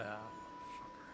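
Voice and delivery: normal talking voice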